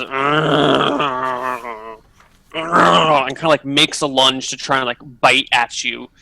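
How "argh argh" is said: lightly voiced lurching undead groan; a hint of a hungry zombie growl.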